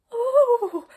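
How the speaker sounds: high, loud cry of dismay; distressed cry. A narrator's reading. Queen crying out as the princess vanishes, distressed cry. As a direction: wail out in sudden distress. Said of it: "jumping up in terrible distress".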